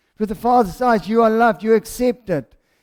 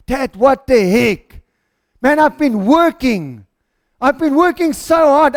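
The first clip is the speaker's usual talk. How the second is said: loud, raised, aggrieved rant; fuming; 3 semitones higher, 5 dB louder than usual. Acted. Older brother giving his dad a full blow, fuming. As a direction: pour out the grievance at full blow. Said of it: "this boy is fuming".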